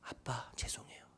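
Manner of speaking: whispered, low apology; timid son answering his father; meek apology. Retold or imitated. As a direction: shrink to a barely audible sorry. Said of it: "이 아들이 뭐라고 대답한".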